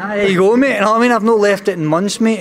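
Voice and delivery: high, loud Scouse lilt; playful Scouse accent. Pretend. Boxer teased about Liverpool months, hamming up Scouse. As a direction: play up the Scouse, pitched right up. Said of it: "I can hear the Scouse accent".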